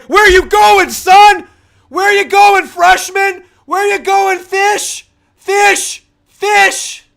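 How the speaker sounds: screamed very high and loud; screaming taunt. Real, not acted. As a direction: bellow the taunt at the screen. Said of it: "It's a T V you're yelling at".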